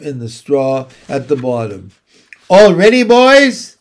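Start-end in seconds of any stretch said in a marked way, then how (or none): 2.5-3.8 loud, high rallying call; cried out. A narrator's reading. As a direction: holler it to rally the crew. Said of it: "cried Mister Zuckerman".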